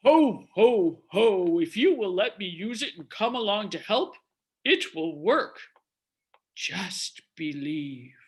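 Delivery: big hearty ho-ho-ho, then ask gently; Santa Claus. Acted. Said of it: "Santa answers".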